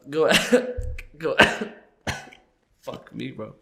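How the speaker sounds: ordinary talk, nothing marked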